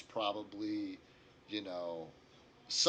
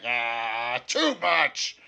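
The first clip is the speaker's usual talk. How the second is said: protesting dwarf; 11 dB louder, much slower than usual. Acted. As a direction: burst out in loud protest. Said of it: "The dwarf's like".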